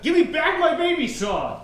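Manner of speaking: high, indignant call after a thief; indignant yell. Acted. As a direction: call out high to get it back. Ben chases the thief.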